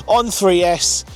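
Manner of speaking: usual speaking voice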